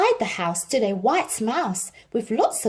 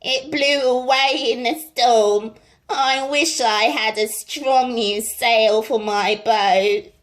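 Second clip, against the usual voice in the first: louder goat voice; bleating goat; 2 semitones higher, 5 dB louder than usual. A narrator's reading. The goat wishing for a new sail, bleating. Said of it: "bleating loudly".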